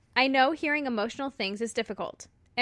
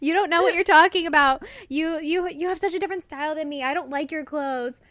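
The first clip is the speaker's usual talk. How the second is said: louder, higher mock teenage protest; exasperated teen retort; 5 semitones higher, 4 dB louder than usual. Daughter re-enacting high school clothes quarrel with mom, exasperated protest. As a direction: push back at Mom, playfully overdone.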